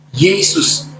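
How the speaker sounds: ordinary talk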